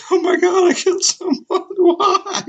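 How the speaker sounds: squealing high mock panic with a laugh; pretend panic. Imagining having killed someone, a hammed-up high panicked squeal. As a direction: freak out in a shrill, laughing squeal. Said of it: "I'd be like".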